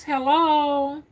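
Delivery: ordinary talk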